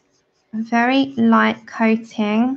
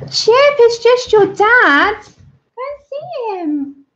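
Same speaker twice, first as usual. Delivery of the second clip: very high, drawn-out voice to a dog; high, slow reassurance for an overexcited dog; pet talk; 12 semitones higher, 5 dB louder, slower than usual. Real, not acted. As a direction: coo slowly to a dog, pitched way up. Talking to crying dog.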